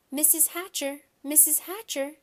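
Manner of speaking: high eager calling. A narrator's reading. Girl calling to a friend's mother, high eager call. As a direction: call out sweetly for attention. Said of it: "Shyla called".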